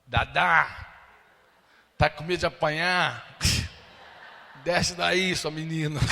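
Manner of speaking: playful loud call. Retold or imitated. Stepmother teasingly shouting to his mother, loud and playful. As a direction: call her out of the car like an old friend.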